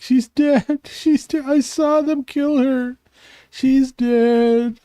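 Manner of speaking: put-on high, wailing young man crying over her death; mock crying. Grieving son tells the party she's dead, wailing. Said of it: "he starts to cry again".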